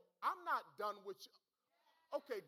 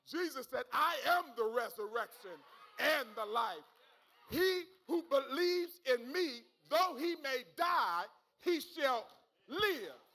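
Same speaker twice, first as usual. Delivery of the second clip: loud, raised preacher's shout; full-force preaching; 8 dB louder than usual. Real, not acted. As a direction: proclaim it to the congregation, stretching key words.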